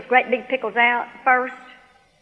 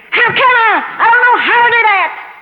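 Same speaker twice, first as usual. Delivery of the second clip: high, shouted exasperation; mad; 12 semitones higher, 10 dB louder than usual. Retold or imitated. Woman shouts at God for an answer, mad. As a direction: cry out in angry frustration. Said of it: "finally, I got mad".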